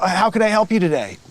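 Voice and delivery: ordinary delivery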